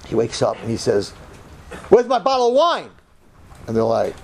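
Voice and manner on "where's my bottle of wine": high, louder demand for the wine; indignant demand. Retold or imitated. Drunk guest waking up demands his wine, loud and high. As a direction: bark it like a groggy drunk.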